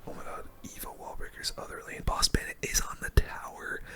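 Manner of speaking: ordinary delivery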